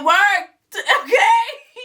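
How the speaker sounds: laughing through words. Real, not acted. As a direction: laugh as you say it. Laughing at own joke.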